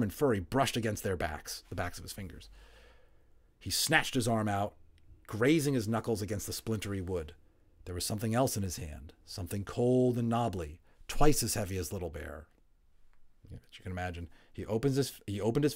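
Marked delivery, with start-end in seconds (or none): none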